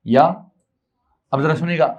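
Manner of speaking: normal talking voice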